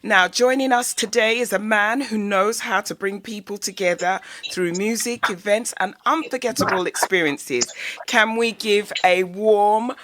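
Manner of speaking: loud, upbeat radio intro; radio host. Acted. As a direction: welcome the listeners like a DJ. Radio show welcome announcement.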